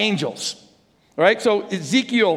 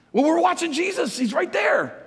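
puzzled disciples; 9 semitones higher than usual. Retold or imitated. Disciples answering the angels.

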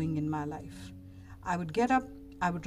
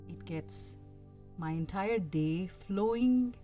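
calm guiding voice, unhurried; soft spa whisper; 3 dB quieter than usual. Acted. Leads a guided meditation.

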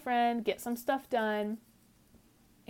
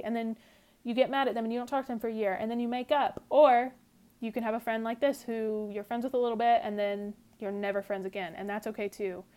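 normal talking voice

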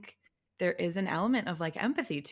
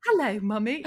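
put-on higher, louder British child greeting mum; British accent; 6 semitones higher, 7 dB louder than usual. Girl greets her mom after weeks at British friend's, put-on British accent. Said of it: "just being British".

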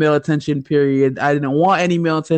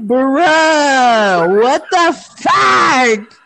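high, drawn-out shocked shout; a high, slow "what the fuck"; shocked outburst; 7 semitones higher, 3 dB louder, much slower than usual. Retold or imitated. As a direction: yell it in total disbelief. Retold shock at the dude.

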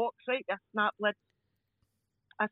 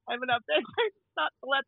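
ordinary talk

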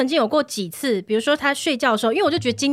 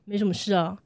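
low, much softer, withdrawn reply; mock sullen mumble; 6 semitones lower, 6 dB quieter than usual. 妻子学生闷气的老公，低声闷闷回答. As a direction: answer like a sulking husband. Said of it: "然后他就会说".

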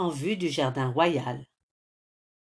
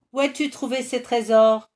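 greedy; 5 semitones higher, 7 dB louder than usual. A narrator's reading. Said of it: "avec convoitise".

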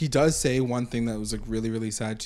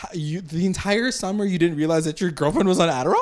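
higher, louder disbelieving question; incredulous; 6 semitones higher, 5 dB louder, faster than usual. Retold or imitated. Asking a friend about his oblivious summer, incredulous. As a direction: ask it in mock disbelief.

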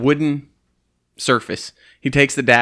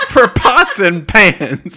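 higher, louder, slower, helpless with laughter; laughing harder; 7 semitones higher, 9 dB louder, much slower than usual. Real, not acted. Repeating the joke to the table, laughing even harder. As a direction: squeal the words through a laughing fit.

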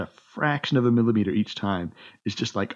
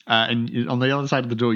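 usual speaking voice

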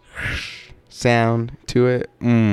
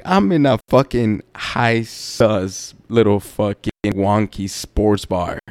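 ordinary talk, nothing marked

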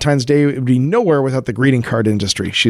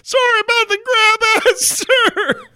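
high, crying soldier apology; whiny soldier. Acted. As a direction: whimper an apology to the general.

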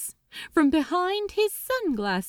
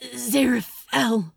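panting. Acted. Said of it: "he panted".

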